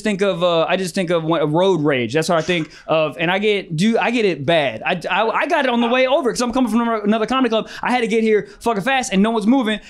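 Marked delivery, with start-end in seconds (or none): none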